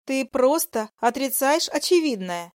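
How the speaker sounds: high, pleading female voice; seductive mistress. Acted. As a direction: press your claim on him.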